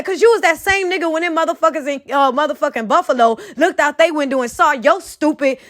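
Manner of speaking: usual speaking voice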